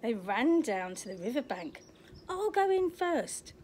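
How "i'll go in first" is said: slow, higher, mildly hammed-up father; lightly voiced dad, taking it slowly; dad's voice. Father telling Oliver he goes first, lightly voiced. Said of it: "said his dad".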